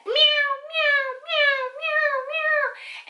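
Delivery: high, loud imitated meows; imitating a loud, upset Bengal cat; cat meowing. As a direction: meow like a frantic cat in a car. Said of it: "the whole way to the vet".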